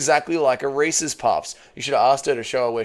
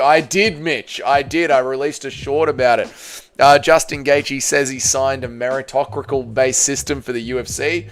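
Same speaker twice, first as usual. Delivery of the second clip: ordinary delivery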